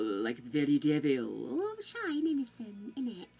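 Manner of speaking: evil voice. Shown as a demonstration. Streamer demos voice acting with a light evil devil voice. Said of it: "use your voice to kind of be evil".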